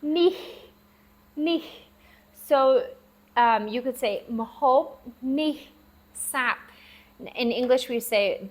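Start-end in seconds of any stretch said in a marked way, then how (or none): 0.0-1.6 nih said high and short; teacher demos the Khmer word for this. Shown as a demonstration. 4.3-6.6 Mahop nih sap said slowly, word by word; careful classroom Khmer sentence; Khmer phrase. Shown as a demonstration. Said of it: "they say".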